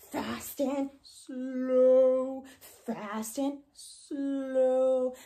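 quick 'fast', stretched 'slow'; fast versus slow. Shown as a demonstration. Teacher contrasting fast and slow by pace. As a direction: drag out the word slow.

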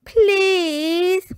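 high, loud, wheedling please; Peppa, pleading. A narrator's reading. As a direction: beg like a child, pitched well up. Peppa begs Daddy for sleepover.

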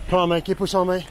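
loud encouraging call; rally the tired group. Real, not acted. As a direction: shout it across to the marchers. Shouting encouragement to marcher.

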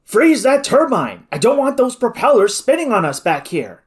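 high, loud urgent order; yelling. A narrator's reading. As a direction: yell it into the mic over the danger. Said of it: "Gardner fairly yelled into his microphone".

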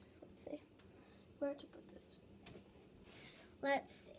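unvoiced whisper to herself. Real, not acted. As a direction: whisper while thinking. Whispering to self.

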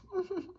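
small high-pitched giggle; imitated giggle. Teacher amused by the student's jibe, imitated giggle. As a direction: mimic a teacher's little titter. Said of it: "even the teacher kinda giggles".

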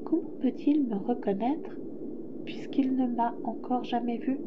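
soft, musing prince voice; quiet inner question; wondering to oneself. A narrator's reading. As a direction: wonder it softly to yourself. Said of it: "le petit prince se demanda".

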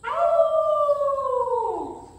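high, loud howl; long rising dog howl. Shown as a demonstration. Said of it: "chicken dogs howl".

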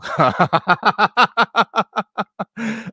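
big burst of laughter; hearty loud laugh. Real, not acted. Laughs after quoting family.

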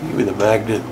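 usual speaking voice

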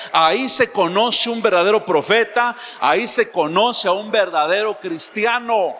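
loud, preaching at full force; forceful preaching. Real, not acted. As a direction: hammer it home from the pulpit. Preacher proclaims true prophet.

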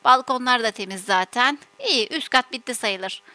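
low, soft self-talk while busy; muttering to herself. Acted. Mother tidying up after the children, muttering.

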